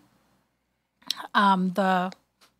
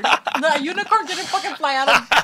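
laughing; 6 semitones higher than usual. Real, not acted. Laughing at the reading story.

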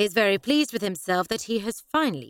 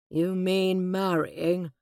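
gloomy; much slower than usual. A narrator's reading. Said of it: "said my father gloomily".

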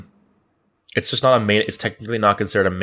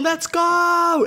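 hype man. Retold or imitated. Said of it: "hype man".

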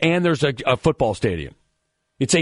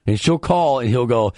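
usual speaking voice

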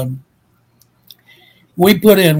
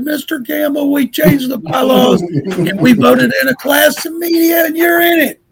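high-pitched nervous blurt, rising higher to the end; voice got real high; 10 semitones higher than usual. Retold or imitated. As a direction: squeak it out nervously, voice climbing. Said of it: "my voice got real high".

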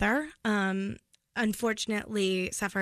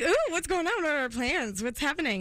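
1 teasing nosy daughter; 6 semitones higher than usual. Retold or imitated. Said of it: "makes me wanna drag out the conversation".

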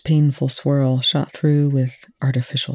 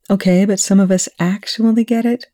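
annoyed; 7 semitones higher than usual. A narrator's reading.

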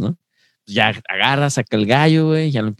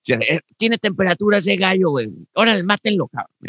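rowdy cockfight spectator; 9 semitones higher than usual. Pretend.

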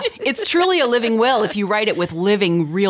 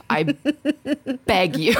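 laughing; 8 semitones higher than usual. Real, not acted. Laughing while pleading.

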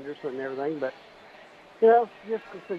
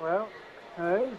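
normal talking voice

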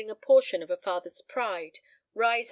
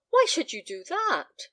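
high, louder pleading question; imploring; 9 semitones higher, 5 dB louder than usual. A narrator's reading. Daughter challenging her father's decision, imploring. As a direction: plead with a raised, protesting voice. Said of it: "cried Helen Clive imploringly".

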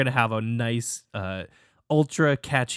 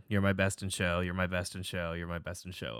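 chorus trailing off softer and softer; fading repetition; 5 semitones lower, 10 dB quieter than usual. Shown as a demonstration. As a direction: let each repeat fade away. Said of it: "we have to repeat".

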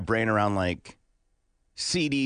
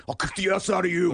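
high dim-witted brute voice; booming tough mom impression; Ogre voice; 8 semitones higher than usual. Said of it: "my impression of Gimpy's mom sounds like Ogre".

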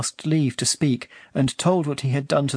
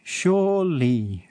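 slow drawn-out repeat; 3 semitones higher, much slower than usual. A narrator's reading. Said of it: "said Puck".

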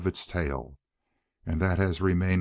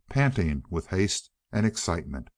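ordinary delivery

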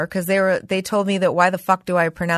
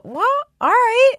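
mock meek reply; 8 semitones higher than usual. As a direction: sing out a sheepish "all right". Mock polite reply to critics.

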